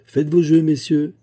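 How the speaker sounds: loud dealer's call to the players; croupier's call. A narrator's reading. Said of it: "cria le banquier".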